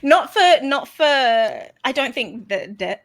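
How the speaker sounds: laughing. Real, not acted. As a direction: talk through loud laughter. Laughing through the words.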